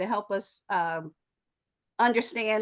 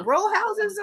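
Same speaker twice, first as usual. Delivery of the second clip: ordinary talk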